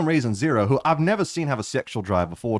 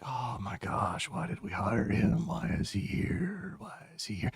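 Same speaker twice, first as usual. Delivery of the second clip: exasperated mutter; 3 semitones lower, 11 dB quieter, slower than usual. Acted. Melvin mutters in despair.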